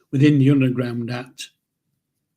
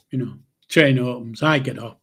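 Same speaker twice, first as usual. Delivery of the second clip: normal talking voice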